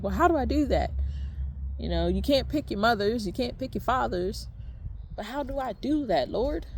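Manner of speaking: high, exasperated pleading to God; frustrated plea. Retold or imitated. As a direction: throw up your hands and ask, exasperated. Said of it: "now I'm getting frustrated".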